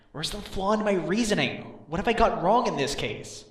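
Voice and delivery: stumped and exasperated; agonized frustration. Acted. Agonizing over his own failed reasoning.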